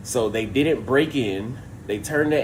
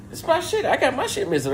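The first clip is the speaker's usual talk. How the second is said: exasperated outrage; 14 semitones higher than usual. Retold or imitated. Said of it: "So I'm like".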